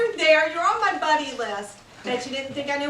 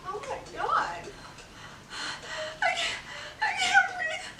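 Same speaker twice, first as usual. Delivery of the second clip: much higher, gasping, half sob; hysterical laugh; 12 semitones higher, 6 dB quieter than usual. Acted. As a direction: gasp for air in shock. Wife sees cheating photos.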